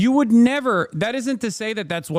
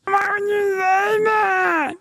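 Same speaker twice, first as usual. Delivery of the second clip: much higher, slow, whiny mock cry; soy notes; slower than usual. Streamer mocks the edgy jokers' offended reaction, whiny falsetto wail. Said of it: "immediately they hit the fucking soy notes".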